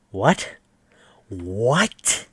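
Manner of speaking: stunned, rising What? What?; baffled repeat of the question; disbelief. Real, not acted. Reacting to the plot twist, stunned disbelief.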